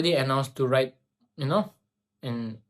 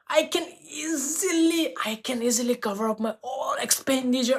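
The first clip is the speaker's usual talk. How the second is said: overjoyed; 10 semitones higher than usual. Retold or imitated. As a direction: savour it like a dream come true. Joy about affording college.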